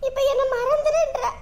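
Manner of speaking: tearful, helpless lament; crying. Acted. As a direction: pour out grief to your sister through tears. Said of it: "நீ அழுகாத". (அழுதுகொண்டே)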